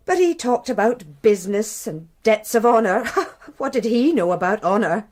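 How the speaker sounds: laughing scornfully through the words; wife mocking her dead lover's honour, laughing; bitter laugh. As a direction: scoff at his idea of honour.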